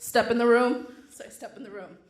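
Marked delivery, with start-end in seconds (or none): 0.0-0.9 barked order. Retold or imitated. Guard orders her aside.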